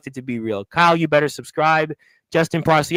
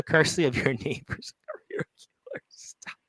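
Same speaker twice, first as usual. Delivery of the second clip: whisper; 2 semitones lower, 6 dB quieter than usual. Acted. Reading a cheeky chat comment.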